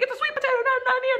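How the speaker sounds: very high-pitched mimicked children; protesting kids. Students contradicting their tearful teacher, high-pitched protest.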